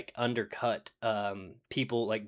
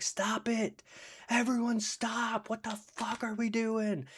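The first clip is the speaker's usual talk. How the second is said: imitating a man begging everyone to stop; anguished outcry; 12 semitones higher, slower than usual. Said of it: "and he's like".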